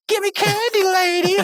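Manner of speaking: very high, hammed-up candy demand; mock demanding squawk. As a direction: squeal it like a cartoon robber. Imitates Rigby demanding candy from woman.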